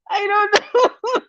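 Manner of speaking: high and very slow, stretched through laughter; laughing. Real, not acted. Host can't decide if the viewer is a troll, stretched out laughing. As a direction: drag the words out while cracking up.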